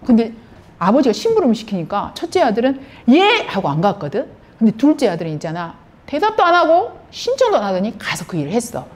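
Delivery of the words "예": high, loud imitated yes; mock-dutiful shout of assent; eager obedient reply. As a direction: pipe up like a willing son. First son's eager yes, acted out.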